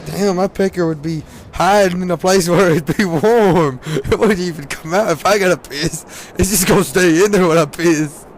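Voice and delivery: half-laughing retold banter; laughing mock quote. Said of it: "we were like".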